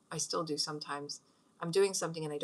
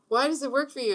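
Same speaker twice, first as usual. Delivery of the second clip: put-on higher, louder curious kid; son asking; 8 semitones higher, 7 dB louder than usual. Mother imitates her son asking why it works, higher and louder. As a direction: ask it like a puzzled boy. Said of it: "and he's like".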